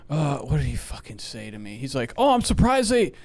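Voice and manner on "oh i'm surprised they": higher, mocking imitation of a nagging dad. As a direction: parrot the dad's whiny nagging, pitched up. Mocking his dad's nagging.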